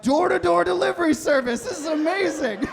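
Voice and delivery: high, loud, giddy delight; thrilled rider cheering the ride; mock delight. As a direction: gush like it's the best deal ever. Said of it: "I'm like".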